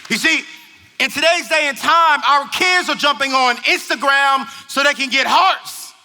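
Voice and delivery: loud, high, driving sermon voice; full-force preaching. Acted. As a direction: preach it hard to the parents.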